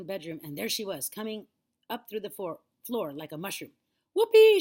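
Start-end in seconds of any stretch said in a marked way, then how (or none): 4.2-4.6 very high, loud whoop; whooping shout. A narrator's reading. Grandma bursting up through the floor, joyful shout. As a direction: let out a jubilant whoop. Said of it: "she shouted".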